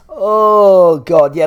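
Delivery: a loud, high exasperated "oh". Real, not acted. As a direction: throw up your hands at Eleanor, out loud. Exasperated at Eleanor again.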